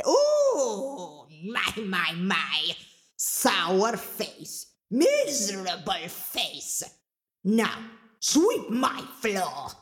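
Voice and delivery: slow, drawn-out ogress voice; ogress Mama Draga, scornful. Acted. Mama Draga sizing up sour-faced Angelina, scornful. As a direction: relish each insult, then order.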